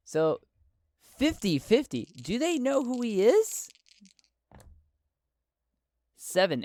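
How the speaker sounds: normal talking voice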